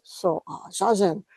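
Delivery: lightly imitated Japanese accent, halting with pauses. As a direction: mimic a Japanese teacher's accent, searching for words. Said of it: "he had a pretty heavy Japanese accent".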